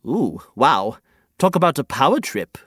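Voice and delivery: ordinary talk, nothing marked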